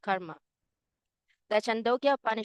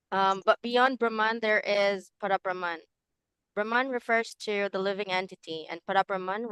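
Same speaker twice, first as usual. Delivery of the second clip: louder, closer to the mic. Real, not acted. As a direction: speak up so the class can hear. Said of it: "Is my voice very low".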